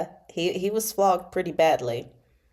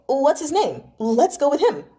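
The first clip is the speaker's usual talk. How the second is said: higher, louder eager burst; overjoyed; 8 semitones higher, 3 dB louder than usual. Acted. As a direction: jump at the idea with glee.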